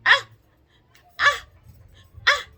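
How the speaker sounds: short, loud "ah" cries, spaced apart; pained yelps. Retold or imitated. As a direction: imitate flinching at each jab. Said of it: "I kept going".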